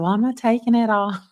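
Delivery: high, loud mock defiance; defiant quip to the deputy; sassy retort. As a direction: play up the cheeky refusal. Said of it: "I said".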